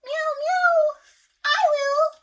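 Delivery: very high, loud meowing cat; eager cat. Acted. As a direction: mew keenly for the bread. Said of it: "said the cat".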